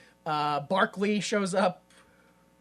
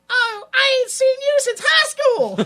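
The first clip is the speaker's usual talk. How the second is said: very high, loud, drawn-out hollering; overacting background extra; 9 dB louder, slower than usual. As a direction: screech it like a hammy Southern extra. Mimics a background actor calling out.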